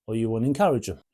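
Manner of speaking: normal talking voice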